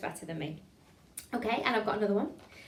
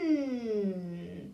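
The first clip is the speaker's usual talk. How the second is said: swooping closed-mouth hum; hummed siren. Shown as a demonstration. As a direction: hum that glides up and falls away. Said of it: "so like".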